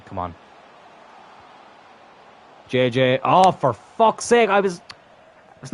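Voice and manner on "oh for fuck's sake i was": loud, high, drawn-out curse; raised voice, stretched with exasperation; exasperated outburst. Real, not acted. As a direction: groan the curse out in real frustration. Missed chance in the game.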